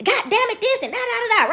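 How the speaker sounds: high-pitched mock cursing rant; imitated hollering. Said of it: "he started screaming and hollering".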